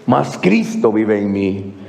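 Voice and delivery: low, slow, weighty delivery; grave, deliberate pulpit quotation; solemn scripture quote. Acted. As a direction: drop low and slow to land the verse. Solemn completion of Paul's verse.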